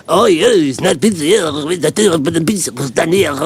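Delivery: light mock French accent, drawn out slowly; a hint of a French receptionist, slow and lazy. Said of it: "I don't know why he was French".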